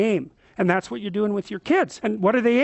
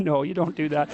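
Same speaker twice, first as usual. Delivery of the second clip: ordinary talk, nothing marked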